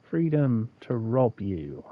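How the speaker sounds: slow deadpan. Acted. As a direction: drop it flat and drag it out slowly. Deadpan follow-up to cheer.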